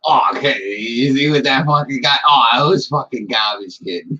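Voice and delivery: Boston sports fan griping; thick Boston accent. Shown as a demonstration. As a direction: thicken the Boston accent, ranting about a game. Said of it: "It'd probably be like".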